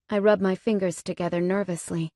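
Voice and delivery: plain reading voice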